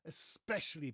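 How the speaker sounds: "Especially" with s and no k; correct especially. Shown as a demonstration. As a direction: model "Especially" as es-PESH-uh-lee. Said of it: "there's no X".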